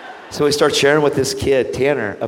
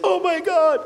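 put-on much higher, gasping girl's voice; imitating an amazed waitress; stunned; slower than usual. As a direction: gasp it in shock.

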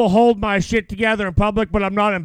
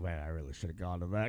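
regretful mutter; 12 semitones lower, 17 dB quieter, faster than usual. Retold or imitated. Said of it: "be like".

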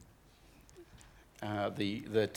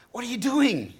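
frustrated yell; 10 semitones higher than usual. Retold or imitated. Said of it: "you jump out and you're frustrated".

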